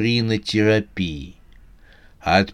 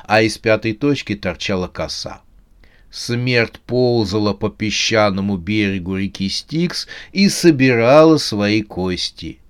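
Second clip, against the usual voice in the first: plain reading voice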